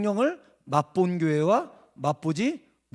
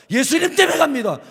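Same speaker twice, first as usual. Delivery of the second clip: loud confession; 9 semitones higher, 9 dB louder than usual. Retold or imitated. Kid's confident confession.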